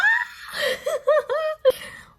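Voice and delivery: high squealing laugh-scream; shrieking laugh. Real, not acted. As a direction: squeal with delight at the reveal. Lip reveal squeal.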